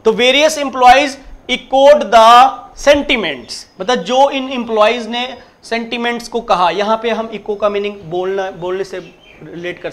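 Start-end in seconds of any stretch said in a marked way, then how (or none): 0.1-3.8 very slow and louder, every word stressed; slow, loud classroom read-out; slow emphatic reading. Shown as a demonstration. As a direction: read the right answer out clearly. Teacher reads correct sentence.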